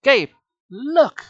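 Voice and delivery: high, loud call of alarm; urgent cry. A narrator's reading. Sari alerts Gabe in the tunnel, crying out. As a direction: cry out to grab his attention. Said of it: "she cried".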